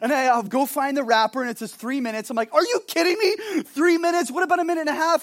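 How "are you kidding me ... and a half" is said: much higher, indignant disbelief; mock outrage. Pastor reading three minutes on the wrapper, mock outrage. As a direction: rant at the wrapper in mock fury. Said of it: "and I got all angry".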